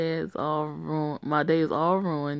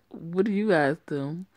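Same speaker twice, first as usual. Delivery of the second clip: usual speaking voice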